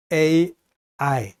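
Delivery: slow, letter-by-letter 씨에이아이; spelling out. Shown as a demonstration. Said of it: "요 발음 끼워보세요". (씨에이아이를 한 자씩 천천히)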